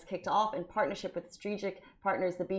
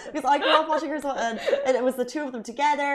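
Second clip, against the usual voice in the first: higher, louder, laughing excitement; breathless fangirl chatter; excited, laughing; 6 semitones higher, 5 dB louder, faster than usual. Real, not acted. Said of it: "I got so excited".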